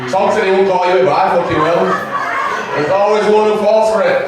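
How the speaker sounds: loud, high gleeful taunt; mock accusatory shout. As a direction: shout it out to tease the audience. Mock accusation of audience member.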